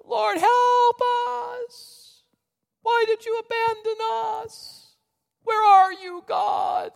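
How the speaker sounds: very high, mock-pitiful plea; wailing prayer. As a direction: wail to heaven, each phrase fading thin. Mimics people praying in desperation.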